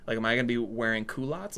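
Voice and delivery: ordinary talk, nothing marked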